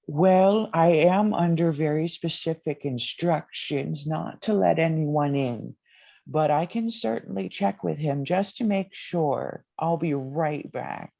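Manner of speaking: put-on high, quavering old butler; fussy elderly butler at the door; very old man. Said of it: "and he goes".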